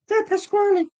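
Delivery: very high squeaky comic voice; Joe Pasquale squeak. Retold or imitated. Naming the comedian in his own very high squeaky voice. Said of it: "Joe Pasquale, that's the man".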